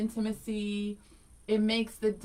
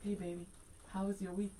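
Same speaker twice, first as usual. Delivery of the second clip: much softer, intimate murmur; soft husband voice; 3 semitones lower, 13 dB quieter, faster than usual. Retold or imitated. Wife imitating her husband's soft date talk. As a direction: croon it softly like a husband on a date. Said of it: "He'll be like".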